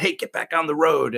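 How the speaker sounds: urgent order, lightly re-enacted; retold urgent order. Officer ordering his driver back onto the road, urgent retold command.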